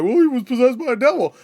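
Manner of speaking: high, loud imitation of an apologist; mock defensive. Imitating people who excuse the killer, mock defensive.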